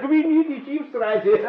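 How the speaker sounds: high, slow, laughing through the words; laughing with joy. Acted. Eder laughing, stunned by his win of a cruise. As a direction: giggle it out in disbelief.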